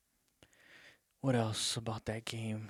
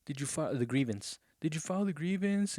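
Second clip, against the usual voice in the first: retold fan heckling; 5 semitones higher than usual. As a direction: imitate fans jeering from the stands. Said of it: "they're yelling like".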